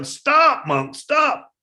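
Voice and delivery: high, loud running yell; a bandit yelling after the monk as he runs. Retold or imitated. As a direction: yell it while chasing. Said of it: "he kept yelling".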